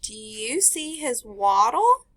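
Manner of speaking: bright storybook read-aloud; teacher reading a picture book to the class; reading to children. Acted. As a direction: read it to a class of little kids.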